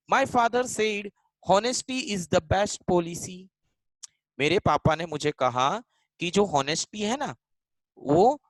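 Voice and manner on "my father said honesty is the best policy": each word drawn out slowly for the class; slow, careful dictation voice. Shown as a demonstration. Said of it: "teacher dictating example sentence".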